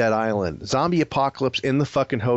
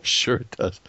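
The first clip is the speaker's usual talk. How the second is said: playful whispered comeback; hushed, voiceless bickering; whispered insistence; 3 semitones lower than usual. Real, not acted. Whispered back-and-forth bickering.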